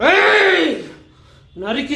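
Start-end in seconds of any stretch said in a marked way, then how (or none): 0.1-0.8 sudden high yell of Hey; villain shout. Acted. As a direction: bark it out high and loud.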